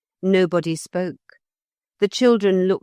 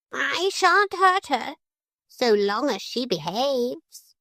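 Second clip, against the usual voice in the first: high, slow prim parrot; 9 semitones higher than usual. A narrator's reading. Parrot reassuring the children while holding the ear. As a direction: reassure with a hint of threat.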